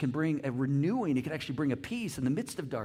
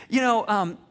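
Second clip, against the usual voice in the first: ordinary talk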